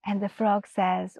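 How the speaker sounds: plain reading voice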